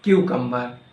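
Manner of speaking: "cucumber" as KYOO-kum-ber; corrected CUCUMBER. Shown as a demonstration. Teacher correcting learners, "cucumber" said as KYOO-kum-ber. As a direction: say "cucumber" starting like the letter Q. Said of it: "No, incorrect".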